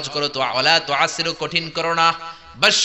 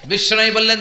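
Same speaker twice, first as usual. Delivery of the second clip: ordinary talk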